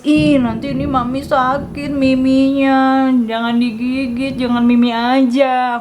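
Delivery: put-on drawn-out sing-song plea to a toddler; pleading mommy voice. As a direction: coax a child with a lilting mock complaint. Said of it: "aku bilang gitu". (suara ibu merajuk, mendayu)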